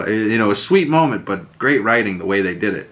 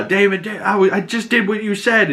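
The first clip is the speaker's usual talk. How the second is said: pleading yell; 9 semitones higher than usual. Retold or imitated. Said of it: "And he yells at Daemon".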